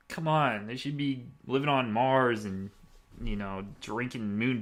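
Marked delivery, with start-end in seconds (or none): none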